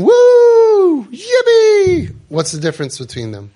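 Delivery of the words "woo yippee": a very high, loud, laughing cheer; laughing whoop. Retold or imitated. Surfer riding the big wave, cheering and laughing loudly. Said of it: "the other one is saying".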